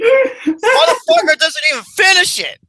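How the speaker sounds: high, loud outburst through laughter; real helpless hilarity; laughing hard. Real, not acted. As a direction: burst out cracking up. Cracking up at the burger bit.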